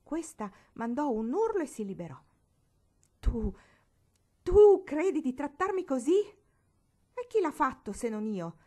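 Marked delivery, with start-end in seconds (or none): 3.2-6.2 much higher, much louder stunned outrage; outraged shriek. Acted. Barbara just punched on the nose, shrieking outrage. 6.2-8.6 slow, louder gloating retort; triumphant retort. Acted. Marigold gloating after punching Barbara, slow triumphant. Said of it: "domandò Marigold trionfante".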